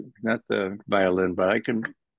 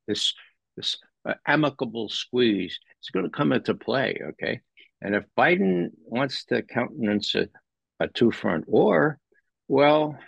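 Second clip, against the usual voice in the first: ordinary talk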